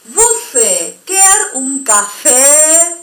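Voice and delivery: slow, drawn-out Portuguese demo; exaggerated slow demo. Shown as a demonstration. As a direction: stretch out Você quer un café. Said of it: "estoy exagerando".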